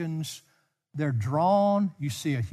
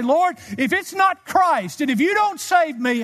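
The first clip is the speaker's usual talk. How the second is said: calling out; 11 semitones higher, 6 dB louder than usual. Retold or imitated. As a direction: cry out to the Lord in urgent prayer. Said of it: "And I call out and say".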